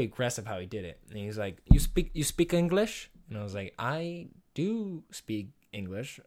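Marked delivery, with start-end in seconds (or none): 1.7-3.1 higher, louder mock Russian accent; put-on Russian accent. Russian man accosting a student on campus, uncertain mock Russian accent. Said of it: "what accent I'm doing right now". 3.8-6.2 drawn out word by word, pitched up; mock slow answer. Student answering a Russian stranger on campus, exaggeratedly slow.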